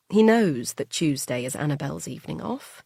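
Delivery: ordinary delivery, nothing marked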